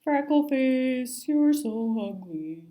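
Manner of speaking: slow taunting fish voice; bully fish mocking another fish; sneering bully. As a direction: sneer the insult out slowly.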